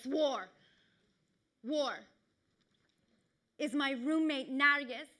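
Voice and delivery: normal talking voice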